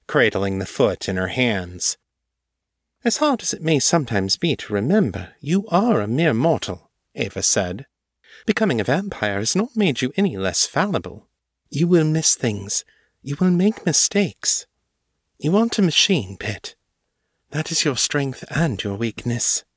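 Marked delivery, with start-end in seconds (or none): 3.0-6.9 high, brisk mistress voice; imperious mistress, reproving. A narrator's reading. Ava reminding Morgan she is mortal, high and imperious. 8.4-19.6 high, brisk mistress voice; imperious mistress, reproving. A narrator's reading. Ava reminding Morgan she is mortal, high and imperious.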